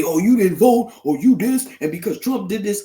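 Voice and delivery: high, loud mocking whine of the critics; loud high mimicry of family critics. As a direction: sneer at the critics in a nasal-free mock voice.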